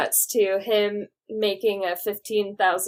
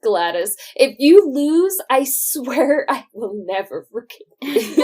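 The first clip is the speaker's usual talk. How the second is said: loud, higher-pitched hammed-up warning; mock threat; 5 semitones higher, 7 dB louder than usual. Acting out a threat to Gladys from the film, hammed-up. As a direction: play an over-the-top threat, breaking into laughter.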